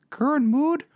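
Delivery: usual speaking voice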